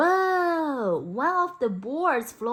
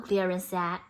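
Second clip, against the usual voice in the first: ordinary delivery, nothing marked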